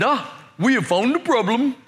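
imitated East Indian-accented gate man. Gate man discovering the clock problem, East Indian accent. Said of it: "morphed from an East Indian accent".

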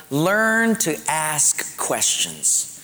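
slow, deliberate delivery; slow dictation. Real, not acted. Preacher dictating a bullet to congregation, slowly. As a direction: spell it out word by word.

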